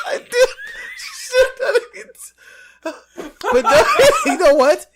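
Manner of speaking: crying with laughter; helpless laughter. Real, not acted. Cracking up at own accent.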